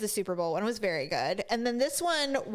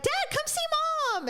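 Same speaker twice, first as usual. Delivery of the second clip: daughters calling dad, pitched way up; excited call; faster than usual. Retold or imitated. Daughters calling father upstairs, excited high call. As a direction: shout up to dad, eager. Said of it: "they're like".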